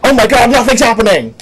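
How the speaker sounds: loud, high hammed-up American outcry; mock American shock. Parodying an American shocked that nothing happens, mock shout. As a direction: yell it like an appalled American. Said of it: "when Americans say it, it's always like".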